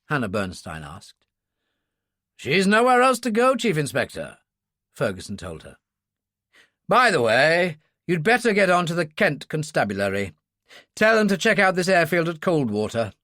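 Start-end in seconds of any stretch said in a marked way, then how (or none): 2.3-4.4 put-on stern older officer voice. Ferguson voiced by narrator. 6.9-13.1 put-on stern older officer voice. Ferguson voiced by narrator.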